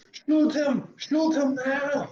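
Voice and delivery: high, loud, drawn-out command; mock shouted order. Acted order after "I'd be like.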